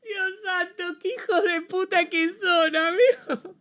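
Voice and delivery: high-pitched fake sobbing in awe; mock crying. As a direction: whimper it like you're overwhelmed by the gift. Overwhelmed by fan-made art again.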